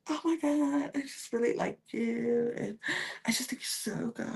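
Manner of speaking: very soft, sweet-talking imitation; mock flirty confession. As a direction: confess a crush in a tiny voice. Voicing Sierra's flirty confession.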